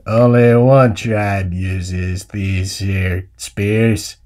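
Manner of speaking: very slow, deep western drawl; John Wayne. Acted. Uncle identifying the Comanche spear, very slow low drawl. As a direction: drag every word out, low and deliberate. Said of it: "doing an impression of John Wayne through my stupid cowboy".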